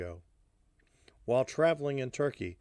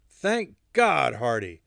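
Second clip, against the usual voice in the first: put-on thick Russian accent; 7 dB louder, slower than usual. A narrator's reading. Alla turns up needing help, thick Russian accent. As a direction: plead urgently with a Russian accent. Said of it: "said in her thick Russian accent".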